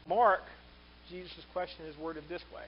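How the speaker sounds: normal talking voice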